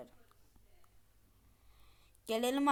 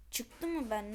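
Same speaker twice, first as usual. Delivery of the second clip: ordinary talk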